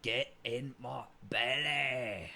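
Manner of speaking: very slow drawn-out Fat Bastard line. Retold or imitated. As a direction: draw it out like a greedy Fat Bastard. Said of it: "what's a Fat Bastard line".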